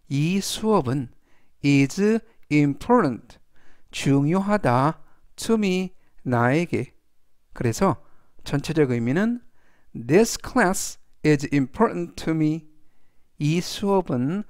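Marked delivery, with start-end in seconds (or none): 1.6-3.4 very slow, deliberate example sentence; slow model reading. Shown as a demonstration. Teacher reading the example aloud to students, slow and clear. 9.9-12.7 very slow, deliberate example sentence; slow model reading. Shown as a demonstration. Teacher reading the example aloud to students, slow and clear.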